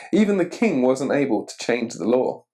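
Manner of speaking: usual speaking voice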